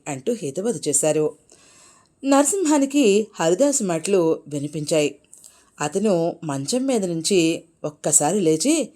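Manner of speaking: plain reading voice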